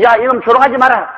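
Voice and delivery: high call thrown back; echoed scolding. Acted. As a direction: mimic an echo telling someone off.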